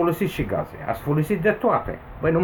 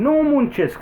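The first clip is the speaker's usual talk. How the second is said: exasperated outburst; 10 semitones higher, 4 dB louder, slower than usual. Real, not acted. Angry rant about his situation.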